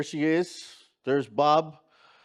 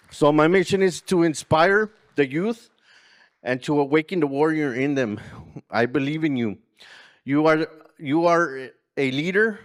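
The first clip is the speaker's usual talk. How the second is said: louder rousing call to the crowd; rallying speech; 2 semitones higher, 6 dB louder than usual. Acted. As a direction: fire up the room, closing the speech. Closing rallying call to youth.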